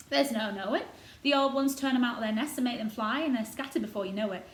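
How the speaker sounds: lightly voiced proud old gardener; a touch of Yorkshire, warming to the robin. Gardener boasting of the robin to Mary, lightly voiced old Yorkshireman.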